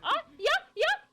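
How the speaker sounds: high, loud yelps; sudden high-pitched game shouts; excited shout. Real, not acted. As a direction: cry out in short, urgent bursts. Startled cries during the game.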